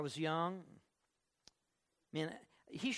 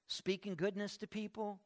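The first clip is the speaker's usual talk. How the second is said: usual speaking voice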